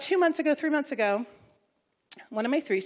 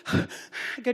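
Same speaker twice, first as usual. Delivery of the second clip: ordinary talk, nothing marked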